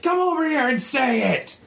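high, strained angry cat voice; high-pitched feline retort; hissing cat. Acted. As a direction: hiss it as a shrill challenge. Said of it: "Ron hisses at him".

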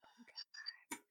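soft whispered oh God. Real, not acted. Reacting to her co-host's laughing, in a whisper. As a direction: hush it out, exasperated.

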